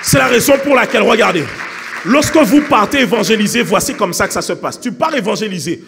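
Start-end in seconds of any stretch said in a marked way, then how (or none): 0.0-3.6 full-force preaching. Real, not acted. Preacher telling believers they need the Saint-Esprit, full-force preaching. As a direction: shout it to the whole congregation.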